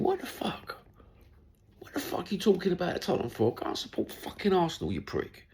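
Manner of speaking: high-pitched, hushed indignant muttering; mock indignation. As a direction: act out an exasperated inner rant, high and soft. Acting out irritated inner thought.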